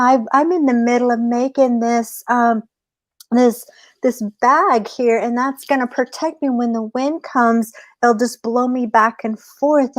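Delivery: high, quick worried plea; pleading rabbit. Acted. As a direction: sell the storm story with fake panic. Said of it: "And the rabbit goes".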